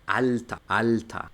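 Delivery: German Alter as "alta", final open a; careful "alta" for learners, no r heard; ER como A. Shown as a demonstration. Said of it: "O la palabra viejo". ("alta", Alter con a final)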